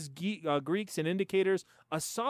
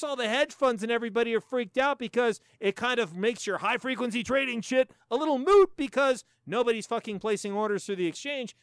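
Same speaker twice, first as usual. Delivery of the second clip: normal talking voice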